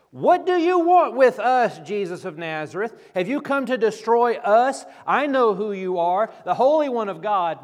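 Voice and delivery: loud, high-pitched frantic shout; possessed man yelling. Retold or imitated. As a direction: cry out like a wild man possessed. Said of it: "he is yelling like someone possessed".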